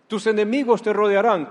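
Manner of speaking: loud warning. Acted. Advertencia de Jesús.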